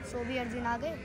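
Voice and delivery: soft, low verse lead-in; hushed recital. Acted. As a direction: recite it quietly, held back. Soft first take of verse. (धीमे, नीचे स्वर में)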